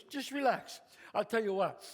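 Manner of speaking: ordinary talk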